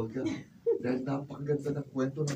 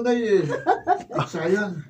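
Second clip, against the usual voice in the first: loud laughing sigh; 6 semitones higher, 7 dB louder, much slower than usual. Acted. Child laughs with mother. (natatawang buntong-hininga)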